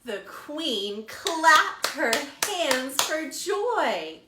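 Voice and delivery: slow, lively storytime narration; animated picture-book storytelling; reading to children. A narrator's reading. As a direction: read it like a librarian to little kids. Librarian reads picture book aloud.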